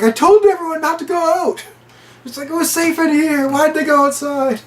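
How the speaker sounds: high-pitched retold lament; mock exasperation. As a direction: act out a distressed I-told-you-so. Franco's retold exasperated complaint.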